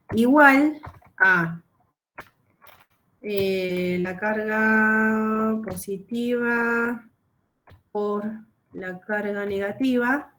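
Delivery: slow, drawn-out words while writing; dictado al escribir. Real, not acted. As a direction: dictate it slowly, stretching words as you write. Dicta mientras lo escribe en pantalla. (dictando despacio, alargando las palabras mientras escribe)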